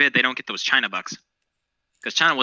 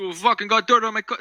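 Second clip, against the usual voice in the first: bad Italian accent; 10 semitones higher than usual. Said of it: "I can't do Italian accent".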